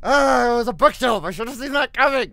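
high, very loud jump-scare outburst; startled yell. Real, not acted. Player startled by a falling bookshelf, startled yell. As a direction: cry out loudly as if just spooked.

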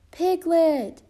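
loud, high call, falling at the end; shout. A narrator's reading. Bear calls for his missing friend, high loud shout. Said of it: "shouted at the top of his lungs".

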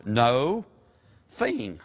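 NO, pause, THING as separate words; split into two words. Shown as a demonstration. Explaining the word's makeup to the congregation, split in two. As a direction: break it into NO and THING with a clear gap. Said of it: "made up of two words".

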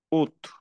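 août as a short U plus T. Shown as a demonstration. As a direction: end août on a sounded T. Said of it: "Una U y una T". (août como U y T)

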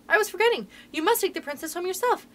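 high-pitched, hurried-feeling urgency; urgent cry. A narrator's reading. Boy jumping up to instruct his mother, crying out urgently. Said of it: "he cried".

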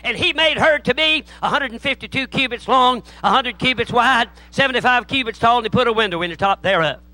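mock preacher's high, loud scripture reading; old-fashioned country preacher. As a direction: proclaim the verse like a country preacher kicking off. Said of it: "Here's what he read".

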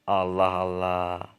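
long drawn-out Allah Allah, slow and weary; "Allah Allah" stretched slowly, weary and fed up; exasperated sigh. Acted. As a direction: drag out "Allah Allah" in tired disbelief.

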